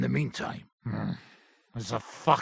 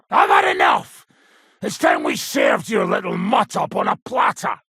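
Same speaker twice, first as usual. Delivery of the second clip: loud, much higher furious shout; angry outburst; 3 semitones higher, 5 dB louder than usual. Acted. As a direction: snap at the dog's owner. Soldier loses patience over dog.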